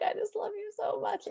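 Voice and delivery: high, soft tearful squeak; hammed-up weepy adoration in a small high voice; mock-tearful gushing. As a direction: coo it to a cat, comically overcome. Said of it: "I look at her and I'm like".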